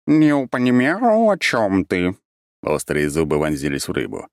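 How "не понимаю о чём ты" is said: cat's voice. A narrator's reading. Cat creature voiced by narrator.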